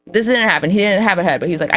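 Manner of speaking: normal talking voice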